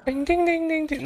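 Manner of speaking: very high bell-like dings; high ding chime. Pretend. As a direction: chime like a quiz-show bell. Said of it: "with my sick voice".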